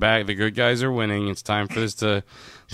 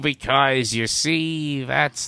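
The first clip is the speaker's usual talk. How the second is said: put-on comic impression, pitched up; drawn-out, higher mimicry of a famous voice; Gilbert; 5 semitones higher, 5 dB louder, slower than usual. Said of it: "That was Gilbert".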